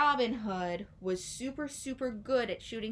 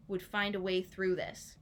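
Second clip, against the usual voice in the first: plain reading voice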